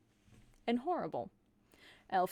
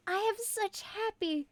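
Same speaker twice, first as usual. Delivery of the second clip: yet another Carol voice; 7 semitones higher than usual. Acted. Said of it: "three fucking voices at this point".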